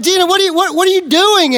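high, agitated mock outburst on the phone; frantic demand. Husband on the phone to his wife, retold in a high, frantic burst. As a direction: pitch jumps up as he demands answers.

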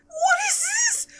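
very high, slow squeal of disbelief; shocked outcry. Retold or imitated. Viewer sees the glasses come off, shocked outcry. Acted shock at actor's reveal.

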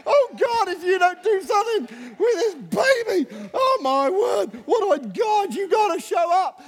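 imitating a frantic new parent's cry, high and breaking; panicked new parent prayer. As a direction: beg God for help like a frantic new parent. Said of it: "When you first have your baby".

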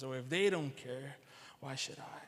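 low, soft, dismissive self-talk; indifferent excuse. Retold or imitated. Churchgoers excusing themselves from helping, low indifferent shrug. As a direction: mutter it to yourself, dropping away.

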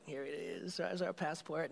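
soft, pleased little murmur; hushed proud aside. Retold or imitated. Traveler quietly pleased with his passport, hushed. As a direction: show off the passport under your breath.

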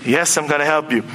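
low, subdued imitation of an agent. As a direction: placate the excited client in a low voice. Retold agent answers excited client.

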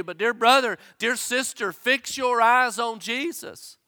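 ordinary talk, nothing marked

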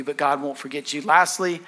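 normal talking voice

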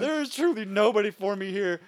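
a hammed-up tale of woe; mock lament. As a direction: sigh it to the room like a lonely guest. Voicing a rejected hotel guest.

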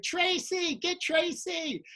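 high-pitched insistent calling; mock a heckler who won't stop; imitated yelling. Lady keeps calling for Tracy, imitated yelling. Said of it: "'cause she won't stop".

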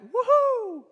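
very high whoop of celebration; party whoop. Acted. Revellers heard from the field, high whoop. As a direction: let out a joyful woohoo.

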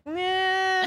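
high, drawn-out no; dismissive. Retold or imitated. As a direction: brush it off flatly. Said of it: "And I'm like".

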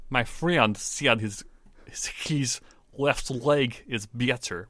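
slow Russian accent, "friend" as "fryend". Shown as a demonstration. Co-host practicing the accent rule, slow Russian accent. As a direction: labor through a Russian accent, "better" as "byetter". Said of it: "E sounds sound more like a ye".

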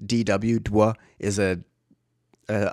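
ordinary talk

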